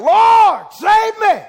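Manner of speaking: desperate high shout for rescue; crying out. Retold or imitated. Sinking Peter shouts to the Lord for rescue, desperate cry. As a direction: Peter calls out as he sinks. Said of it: "He cried out".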